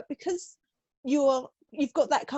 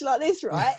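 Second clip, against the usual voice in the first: usual speaking voice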